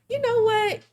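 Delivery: high, loud burst of an idea; excited. Real, not acted. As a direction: pop out with a sudden idea.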